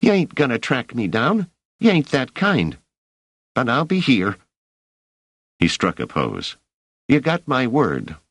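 put-on high boy's voice, cheeky and disappointed; a scrappy street urchin egging her on; street kid. Rache, with narration line between.

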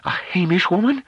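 high, loud disbelief; scornful surprise. Acted. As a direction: recoil in astonished disdain. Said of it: "Delaarmy's eyes opened wide".

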